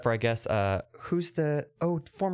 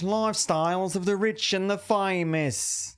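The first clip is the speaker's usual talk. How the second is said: slow, grand announcer voice, higher; Robin Leach impression; 5 semitones higher than usual. Announcing his show title, grand Robin Leach announcer. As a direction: stretch each word like a showbiz host. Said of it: "Much better".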